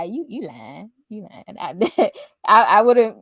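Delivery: normal talking voice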